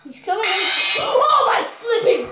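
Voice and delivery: gasping high shout of fright; scared horror game streamer. Real, not acted. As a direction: gasp and yelp in fright. Jump scare moment.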